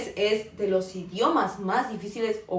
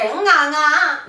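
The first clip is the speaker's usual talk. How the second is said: loud, raised imitation of Cantonese tones; mock shouting; 5 semitones higher, 8 dB louder than usual. Teacher showing how Cantonese can seem like shouting, mock-shouted syllables. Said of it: "que estén como gritando".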